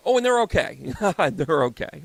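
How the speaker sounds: loud laughing aside. Real, not acted. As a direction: laugh it off loudly. Laughing reassurance.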